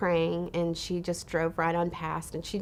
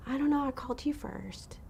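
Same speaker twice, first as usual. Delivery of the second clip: soft, breath-light imitation, slightly high; quietly imitated confession; hushed retold confession; 6 semitones higher, 4 dB quieter than usual. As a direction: say it in a near whisper, as she confided it. Retelling a touching, hushed confession.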